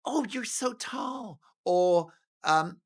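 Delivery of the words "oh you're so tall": higher, slow, syrupy mock gush; a light send-up of fake flattery; mock Meghan gush. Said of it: "she does this with every".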